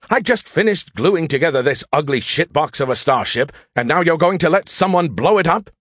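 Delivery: high, indignant complaint; indignant outburst. Acted. AI who rebuilt the ship protesting its destruction, indignant. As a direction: rise into outraged disbelief. AI outraged his ship gets sacrificed.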